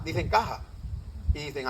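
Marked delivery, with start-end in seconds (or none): 0.3-0.6 "caja" with an aspirated h for j; ja variant. Shown as a demonstration. Lecturer showing the ja group's casa, aspirated middle. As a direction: breathe the j of "caja" as h. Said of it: "incluso la ja".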